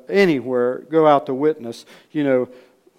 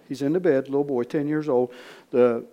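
ordinary talk, nothing marked

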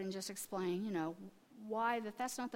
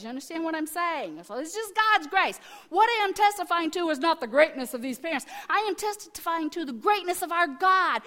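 loud, higher-pitched testifying; fervent preaching; 5 semitones higher, 8 dB louder than usual. Real, not acted. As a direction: proclaim it to the congregation with full force. Preaches at full force.

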